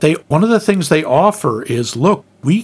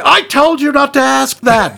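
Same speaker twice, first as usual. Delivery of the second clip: mock scolding; 8 semitones higher than usual. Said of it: "running gag".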